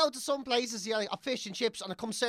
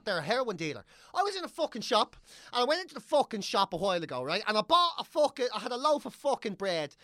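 ordinary delivery

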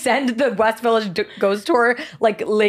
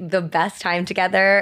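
normal talking voice

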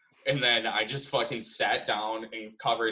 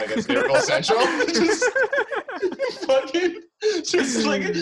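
high laughing words; giggling at his own memory; 6 dB louder than usual. Real, not acted. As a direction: laugh through it.

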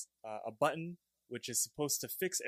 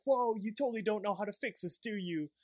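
Rigby's higher, gleeful echo; a mocking higher repeat of the line; 10 semitones higher than usual. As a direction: throw the line back up high, half teasing. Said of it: "Rigby repeats him, being like".

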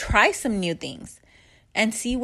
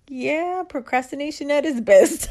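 louder, laughing as she jokes; laughing self-mockery; 5 semitones higher, 5 dB louder than usual. A mom joking to her son about procrastinating, laughing. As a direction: make fun of yourself while laughing.

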